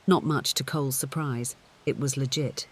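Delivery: ordinary delivery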